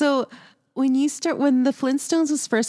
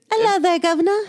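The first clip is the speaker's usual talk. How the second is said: mock Cockney; 6 semitones higher, much faster than usual. Friend mimics the play's Cockney boy, mock Cockney. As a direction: toss off a hammed-up Cockney hello. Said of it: "has a Cockney accent".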